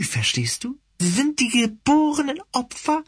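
high, sneering villainous cat. Acted. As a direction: taunt him like a fanatic cat leader. Villain cat Pascal ranting.

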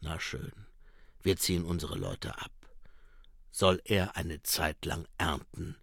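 low, quiet and dry; a tired watchman grumbling; gruff commander. A narrator's reading. Mumm voiced low. (leise, tiefe, trockene Stimme)

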